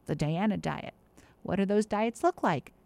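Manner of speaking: ordinary delivery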